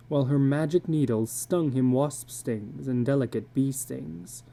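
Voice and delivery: plain reading voice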